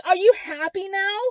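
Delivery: high-pitched, indignant accusation; exasperated retold challenge; accusing. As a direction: throw it in their face. Retold angry confrontation.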